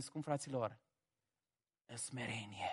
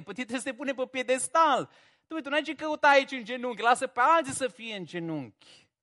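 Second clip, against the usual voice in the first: louder, higher, faster and rising in heat; fervent mock scolding; 7 semitones higher, 4 dB louder than usual. Preacher mock-scolding Elijah for kneeling, fast and rising. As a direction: pile it on as if scolding a hero for kneeling.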